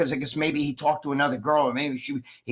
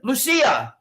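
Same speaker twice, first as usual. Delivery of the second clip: higher-pitched call to someone in the house; 8 semitones higher, 4 dB louder than usual. Real, not acted. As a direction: call her name across the room. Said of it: "everybody likes when I call her".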